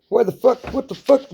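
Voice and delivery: high-pitched mock disorientation; startled, acted confusion; dazed bewilderment. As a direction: act out coming to after a knock. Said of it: "you're all dizzy seeing the stars".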